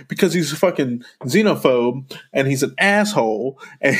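usual speaking voice

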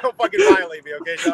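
high, loud mock outrage; mock outraged shout. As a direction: yell it in playful outrage. Mock outrage at editor Josh.

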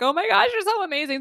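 high, breathless burst of awe; gushing adoration. Retold or imitated. Believer gushing praise to God, awestruck high voice. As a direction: gush it like you can't contain it. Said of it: "You're like".

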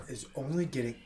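ordinary talk, nothing marked

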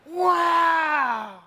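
long, high shouted wow; amazement. Acted. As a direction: draw out an astonished shout. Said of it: "with amazement. They were going".

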